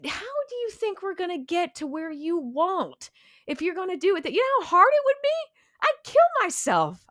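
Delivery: half-joking exasperation, rising to a high frantic pitch; exasperated rant. Retold or imitated. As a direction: voice jumps high, fast and pushy at the end. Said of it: "I'm like, I wanna go".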